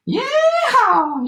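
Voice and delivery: very high, loud yeehaw whoop; cowboy shout. A narrator's reading. Gingerbread cowboy escapes the rancher, high whooping shout. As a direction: let out a high rodeo whoop. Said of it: "he shouted".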